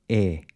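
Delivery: E like the A in say, cut short; a short, tight E; closed E. Shown as a demonstration. Teacher models the Portuguese closed E. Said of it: "the closed E is pronounced".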